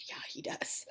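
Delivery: whispered aside; hushed conspiratorial whisper. Real, not acted. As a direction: breathe it like a secret.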